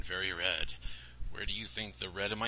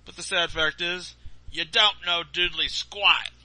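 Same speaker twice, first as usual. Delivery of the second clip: smug Santa; 9 semitones higher, 11 dB louder than usual. Acted. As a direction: lay it on thick. Santa scolds the boy.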